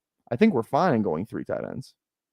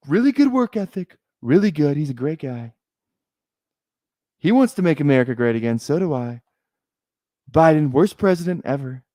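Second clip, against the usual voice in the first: emphatic, praising presidential impersonation; campaign-style boasting in a mimicked politician's voice; Donald Trump; 4 semitones higher than usual. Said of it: "I like doing the Trump accent".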